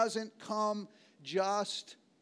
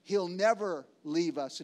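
ordinary talk, nothing marked

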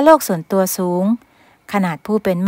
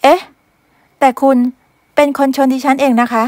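indignant maid; 5 semitones higher than usual. Acted. Maid protesting that the master bumped her. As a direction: protest in disbelief.

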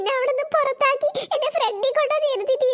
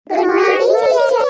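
much louder, a sing-song class greeting; loud chorus greeting; 8 dB louder than usual. Acted. Schoolkids greeting their teacher: loud chorus. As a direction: boom it out like a whole class standing up.

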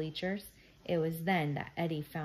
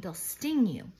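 louder, restrained storybook scream; screamed warning; 3 semitones higher, 6 dB louder, slower than usual. A narrator's reading. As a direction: warn urgently with raised voice. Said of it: "Liza screamed".

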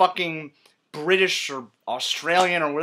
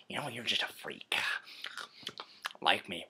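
quiet, low villain murmur; Joker role-play; 6 semitones lower, 14 dB quieter than usual. Acted. Joker needling Batman in interrogation, quiet and low. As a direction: say it softly, almost under your breath.

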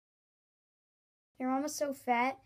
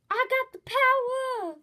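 much higher, louder, slow shout; mock triumphant yell; 13 semitones higher, 10 dB louder, slower than usual. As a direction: belt it like a triumphant cartoon. Mom yelling in the joke.

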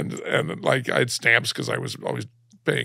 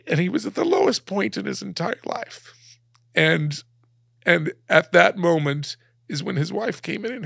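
higher, tight tearful voice; 8 semitones higher than usual. Real, not acted. Guest telling the host how the letter arrived, tearful. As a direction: push through the emotion. Emotional climax of the story.

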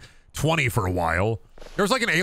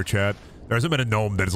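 ordinary talk

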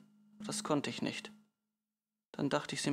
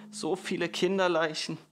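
louder, higher cry of dismay; 4 semitones higher, 3 dB louder than usual. A narrator's reading. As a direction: cry it out, weight on "So viele". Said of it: "rief sie". (bestürzter Ausruf)

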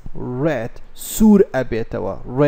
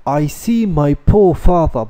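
very slow and louder, word by word; slow dictation reading; 3 semitones higher, 6 dB louder, much slower than usual. Shown as a demonstration. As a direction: read each word out for the class.

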